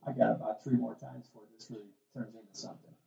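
put-on very soft, quick aside as the kid; unbothered kid. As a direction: murmur the child's private calculation, quietly and quickly. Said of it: "And the kid's like".

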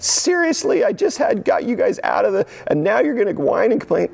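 high-pitched exasperated complaint; mock complaining. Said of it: "He doesn't complain back to them".

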